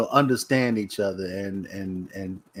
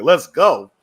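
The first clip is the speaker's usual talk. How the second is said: eager retelling; 5 semitones higher, 9 dB louder than usual. Retold or imitated. Friends eager to join party.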